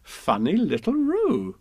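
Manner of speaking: high, slow, fond mother voice; a lightly voiced fond mother; Kanga. A narrator's reading. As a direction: coo it fondly at the little one. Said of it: "said Kanga".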